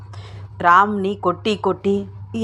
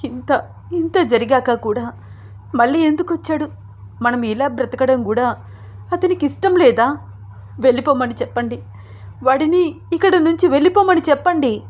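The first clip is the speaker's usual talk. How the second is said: high-pitched anguished outburst; agitated; 5 semitones higher than usual. A narrator's reading. Wife begs husband to send Ram away, agitated. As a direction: protest with mounting agitation. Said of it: "ఆవేశపడుతూ అంటోంది".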